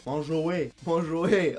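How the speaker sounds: manger dit « manjoué ». Shown as a demonstration. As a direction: glisser de O vers É : manjoué. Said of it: "Le son E devient Oé". (« manjoué » à la québécoise)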